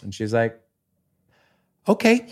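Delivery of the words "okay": very high, louder okay; excited okay. Retold or imitated. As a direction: chirp a delighted okay. Wife agreeing to buy.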